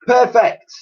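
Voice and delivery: high, loud delighted call. Acted. Professor calls out as the wire tightens. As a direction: cheer it out. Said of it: "called out the professor".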